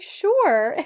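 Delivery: high, loud, cheery sure; eager mother. Retold or imitated. Mother happily agrees to the bedroom tour, high and loud. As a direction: chirp it like a delighted mom. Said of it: "And she's like".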